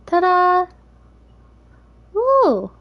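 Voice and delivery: bright high ta-da; excited squeal. Acted. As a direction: burst out delighted. Cupcake reveal, high loud.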